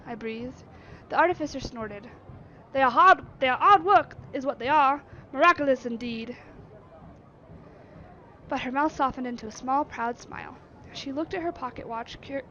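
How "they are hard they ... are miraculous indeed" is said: loud, dismissive Yorkshire retort; gruff Yorkshire. Acted. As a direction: scoff it in Yorkshire. Artificer snorts back.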